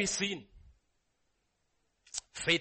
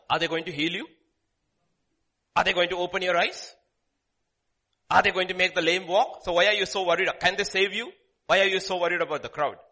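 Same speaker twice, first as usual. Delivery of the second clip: louder, pressing each question; loud preaching challenge; 8 dB louder than usual. Real, not acted. Preacher challenging his congregation, loud and pressing. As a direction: demand an answer from them.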